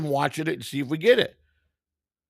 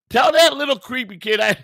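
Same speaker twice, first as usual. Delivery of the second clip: heated protest; 10 semitones higher, 5 dB louder than usual. Real, not acted. Defending himself to viewer.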